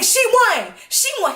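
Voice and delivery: high, hollering hype-man voice; excited shouted announcement. Retold or imitated. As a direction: imitate a boy cheering her win. Boy's excited announcement retold.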